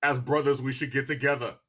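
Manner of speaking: ordinary delivery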